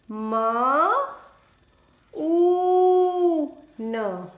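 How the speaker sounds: Ma, Ooh, Na split apart, Ooh long; sounding out. Shown as a demonstration. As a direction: break Moon into Ma, Ooh, Na.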